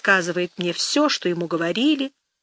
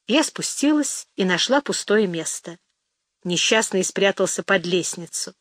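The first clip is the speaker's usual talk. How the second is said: ordinary delivery, nothing marked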